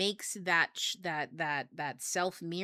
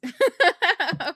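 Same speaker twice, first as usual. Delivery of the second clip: loud burst of laughter; 11 semitones higher, 14 dB louder than usual. Real, not acted. Laughing at not knowing what he sees.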